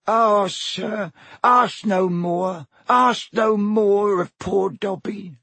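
high, slow, pleading elf; stammering elf. Acted. Frightened elf pleading with Harry, stammering. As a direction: beg him to stop asking. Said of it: "stammered the elf".

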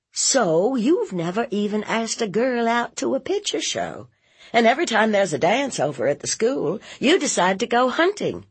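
high, fast list of complaints; nagging aunt. Acted. Aunt nagging nephew about dating, high and exasperated. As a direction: pile up the evidence, exasperated.

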